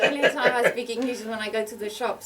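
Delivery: real laughter at her own joke; laughing. Real, not acted.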